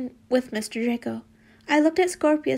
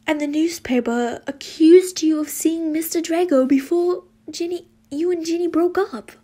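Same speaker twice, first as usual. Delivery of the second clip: loud, higher-pitched urgent suggestion; insistent; 5 semitones higher, 6 dB louder than usual. Acted. Student suggests the culprit to Harry, insistent. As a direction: push it out loud and eager. Student excitedly accuses in story.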